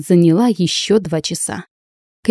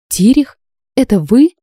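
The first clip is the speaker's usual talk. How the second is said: higher, louder, amazed and questioning; astonished question; 6 semitones higher, 5 dB louder than usual. A narrator's reading. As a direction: ask in open-mouthed disbelief. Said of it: "прошептала я". (удивлённый вопрос)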